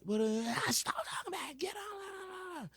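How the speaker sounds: mocked student. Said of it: "he was like".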